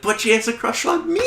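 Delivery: high-pitched mock whine; upset Xander. Xander upset about Dawn's crush, high mock-hurt voice. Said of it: "Xander was a little upset".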